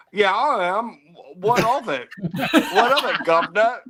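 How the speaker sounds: hammed-up cockney-style British bravado; put-on British accent. American claiming to be from England, hammed-up British accent. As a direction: play up a cheeky British accent. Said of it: "so you're from England".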